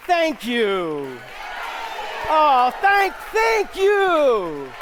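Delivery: very loud and high overwhelmed thanks; mock-humble star drowning in applause; hammed-up grateful shout; shouted gushing thanks. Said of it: "return it to me and say".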